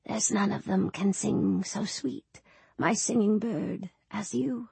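soft, slow murmured lullaby line; hushed, unhurried words to soothe a little one; tender whisper. A narrator's reading. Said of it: "whispered in her ear".

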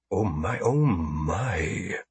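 soft fawning alien voice; smooth-talking alien merchant; ingratiating alien trader. A narrator's reading. Alien apologizes.

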